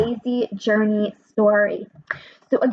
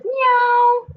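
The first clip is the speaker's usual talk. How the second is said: much higher, drawn-out cute kitty meow; cat meow; 12 semitones higher, 5 dB louder than usual. Acted. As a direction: meow like a little cat calling out. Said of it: "See the cat here? Says".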